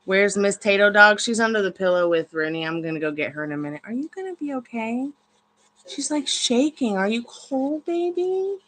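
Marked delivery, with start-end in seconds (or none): 3.8-8.6 cooing to a pet. Real, not acted. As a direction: coo to a trembling little dog. Baby talk to shaking dog.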